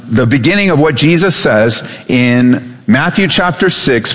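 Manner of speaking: ordinary talk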